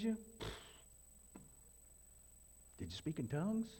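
high, incredulous mocking questions; rising mock interrogation. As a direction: mimic the fussy gatekeepers. Mocking church gatekeepers' questions.

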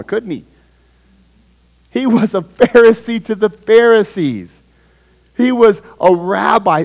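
loud, high, fervent preaching; rising, ringing sermon voice; preaching at full force. Acted. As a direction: drive the point home to the congregation. Pastor preaching to the church.

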